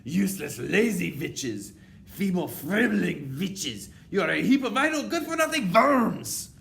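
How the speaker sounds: high, loud witch tirade building to a scream; furious yell. A narrator's reading. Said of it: "she yelled".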